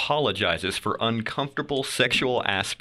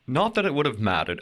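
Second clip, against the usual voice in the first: put-on British accent, pitched higher; 5 semitones higher than usual. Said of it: "I'm doing it in a British accent".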